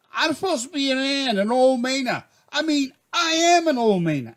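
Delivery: high, worked-up protest; mock screaming. Panicking lobsterman protesting he's an old Mainer, mock screaming. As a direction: wail it high, half screaming. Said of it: "And I'm screaming".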